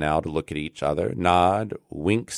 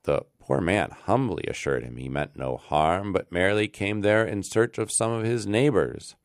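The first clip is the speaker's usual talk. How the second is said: usual speaking voice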